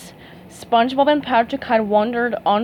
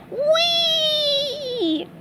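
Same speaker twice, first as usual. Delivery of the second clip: gleeful whee. Acted. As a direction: float the sound up.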